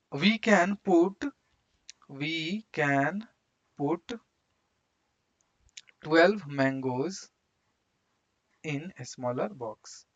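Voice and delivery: drawn-out words with long gaps; slow dictation. Real, not acted. Teacher writing out the mango answer, slow dictation. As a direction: dictate it word by word, unhurried.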